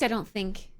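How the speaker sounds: ordinary talk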